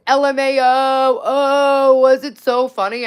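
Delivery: slow, loud sarcastic read; mock him in a drawn-out taunting voice; loud jeering quote of a Tinder match; mocking imitation. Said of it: "And then he goes".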